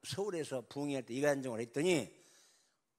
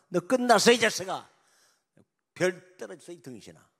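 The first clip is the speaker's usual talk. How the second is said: loud, higher sneering rebuke; mocking scolding; 4 semitones higher, 10 dB louder than usual. Fellow commander loudly berating the general. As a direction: scold him with contempt.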